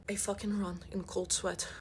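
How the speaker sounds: ordinary talk, nothing marked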